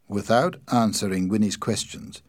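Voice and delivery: ordinary delivery, nothing marked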